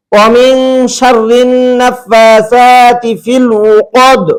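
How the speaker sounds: slow, drawn-out tajweed chant; Quran recitation. Shown as a demonstration. Teacher reciting the correct verse slowly, like a Quran reciter. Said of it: "দেখেন কদ".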